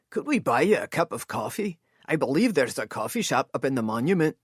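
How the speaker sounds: smooth over the tension kindly; lightly voiced courteous Scot; courteous Scotsman. A narrator's reading. Elias figure voiced.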